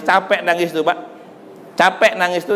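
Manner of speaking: ordinary talk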